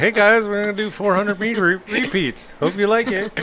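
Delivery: very high, mocking cheerful voice; chipper coach imitation. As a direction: imitate an annoyingly perky coach. Mimics the chipper running coach's announcement.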